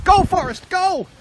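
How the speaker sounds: high, loud cheering shout; calling out. Real, not acted. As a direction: yell encouragement to a runner far off. Calling out to running kids.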